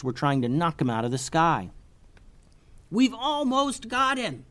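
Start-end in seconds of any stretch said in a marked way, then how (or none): 2.9-4.4 put-on high, slow girl's voice calling out; yelled over battle. Tubes calling to the others mid-fight: high eager yell. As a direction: call out over the din of battle. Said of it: "yelled Tubes over the noise".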